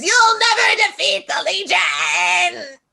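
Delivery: slow, high robot villain shout; sputtering foiled villain. Acted. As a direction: shake your fist, yell the threat. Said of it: "shakes his fist and goes".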